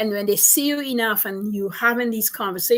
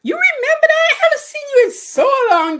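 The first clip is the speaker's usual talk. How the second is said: much higher and louder, squealing with surprise; delighted surprise; 8 dB louder than usual. Retold or imitated. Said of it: "she's like".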